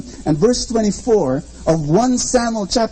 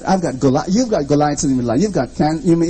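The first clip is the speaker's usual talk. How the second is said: ordinary talk, nothing marked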